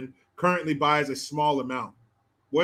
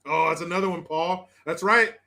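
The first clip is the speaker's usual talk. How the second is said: ordinary delivery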